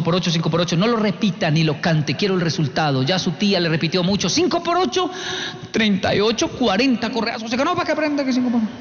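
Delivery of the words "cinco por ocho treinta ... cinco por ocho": mock teacher quiz. Teacher quizzing, child answering wrong.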